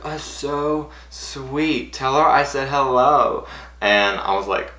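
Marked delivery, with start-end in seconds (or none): 0.0-3.5 high, slow, stretched-out cooing; imitated fond, gushing star; touched. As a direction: draw it out sweetly up high. Star touched, sends greeting.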